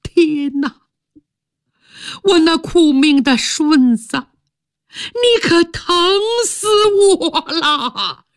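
a father's anguished lament for his son; father crying out over his buried son, wailing; heart-rending cry. Acted. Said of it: "撕心裂肺般的大喊一声".

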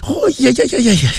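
high, fast rattling cry of pain; pained whoop. Acted. Heshen feeling the plaster burn, high fast pained cry. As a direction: hoot out the burn in a quick high run.

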